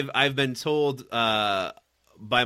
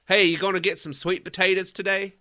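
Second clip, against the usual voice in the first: put-on Southern accent; 6 semitones higher than usual. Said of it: "did it in a Southern accent".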